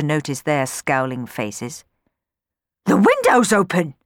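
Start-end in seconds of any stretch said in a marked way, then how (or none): 2.9-3.9 louder startled cry; sudden exclamation. Acted. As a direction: blurt it out in shock. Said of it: "she exclaimed suddenly".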